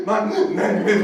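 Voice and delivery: mock sobbing gasps breaking up the words; hammed-up crying, gasping for air; bawling. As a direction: try to introduce yourself through loud, high blubbering. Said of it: "I was a mess".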